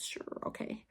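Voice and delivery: whispered sarcastic "Sure, okay". Real, not acted. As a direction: breathe it out, dripping with sarcasm. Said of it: "sarcastic muttered aside".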